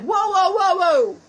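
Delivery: loud, high stunned whoas; mock astonished whoa. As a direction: stop them in awe, loudly. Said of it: "I'm like".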